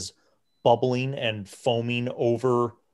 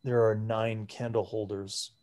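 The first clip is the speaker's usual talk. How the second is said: put-on frail old priest. Frail priest questions the party about the ritual, hushed. Priest assigns candle holders.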